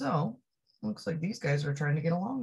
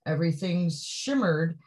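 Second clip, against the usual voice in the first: ordinary delivery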